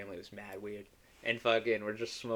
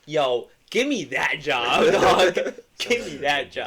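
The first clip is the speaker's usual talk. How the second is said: louder, slow, laughing plea; eager plea; 3 semitones higher, 9 dB louder, slower than usual. Retold or imitated. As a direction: beg for the job half laughing. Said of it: "I was like".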